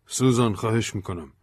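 exasperated, pitch climbing. A narrator's reading. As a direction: protest as if fed up with being misread. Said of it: "بن با کلافگی گفت".

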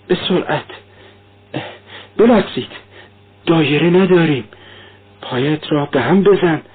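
high, feverish raving, words tumbling out; a mother in fever calling out to her children; voiced high and breathless with fever; delirious dying woman. A narrator's reading. Dying Katerina raves in delirium.

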